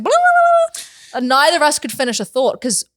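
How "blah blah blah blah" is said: high burst of blah-blah gibberish; babbling noise trailing away; rapid babble. Retold or imitated. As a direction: imitate two people jabbering over each other. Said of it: "we were both just like".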